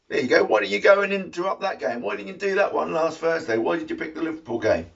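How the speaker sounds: high, fast, exasperated scolding; hammed-up indignant nagging; mock telling-off. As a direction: badger them with quick, high-pitched questions. Mock telling-off of football protesters.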